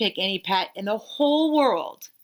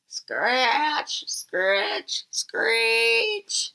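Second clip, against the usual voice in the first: eerie screech; much slower than usual. Acted. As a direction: make the spooky window noise. Spooky noise at the window.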